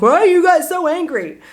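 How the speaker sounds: high-pitched mock outrage; teasing boyfriend. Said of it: "My boyfriend always makes fun of me".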